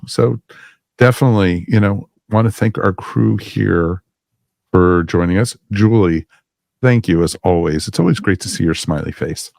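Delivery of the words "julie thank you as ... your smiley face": usual speaking voice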